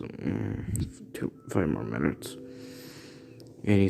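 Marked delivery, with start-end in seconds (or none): 0.0-2.4 lower, softer drowsy mumble; half-asleep, begging for more sleep; sleepy mumble. Acted. Boy woken in his chair, sleepy mumble.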